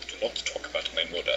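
low, quick imitated African accent. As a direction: quietly put on a heavy African accent. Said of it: "heavy African accent".

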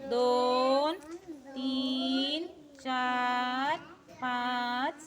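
slow, drawn-out numbers; careful counting. Real, not acted. Teacher leads the careful recount.

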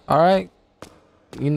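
ordinary delivery

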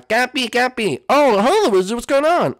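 high, loud, eager greeting; high squeaky Cappy voice. Acted. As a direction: pitch way up, bright and loud. [name] voicing Cappy greeting.

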